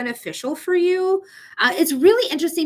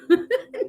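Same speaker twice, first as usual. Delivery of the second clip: giggle; 9 semitones higher than usual. Real, not acted. Laughing at her own mix-up.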